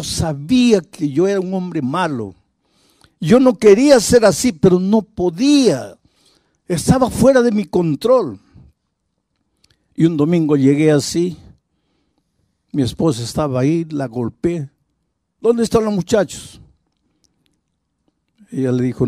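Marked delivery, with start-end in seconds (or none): 3.2-8.4 high, loud, pained cry of despair; anguished confession. Retold or imitated. Repentant man crying out to the pastor in anguish. 15.4-16.6 high, harsh demand barked at the wife; angry demand. Retold or imitated. Violent father demanding his sons from his wife, angrily.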